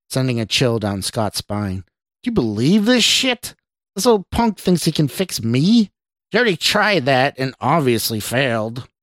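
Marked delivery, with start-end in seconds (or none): 2.2-8.9 scornful stranger. A narrator's reading. Stranger sneers inside Scott's mind.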